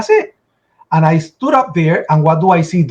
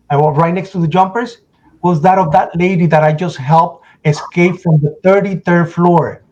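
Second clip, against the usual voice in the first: normal talking voice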